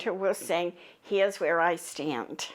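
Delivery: ordinary talk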